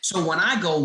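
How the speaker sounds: ordinary talk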